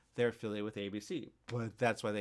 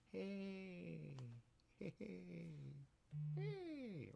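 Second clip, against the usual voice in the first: soft, high, drawn-out heys; mellow, unbothered hey; 8 semitones higher, 13 dB quieter, much slower than usual. Acted. Host acting out just chilling instead of getting angry, soft high mellow heys. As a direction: float each hey out high and quiet.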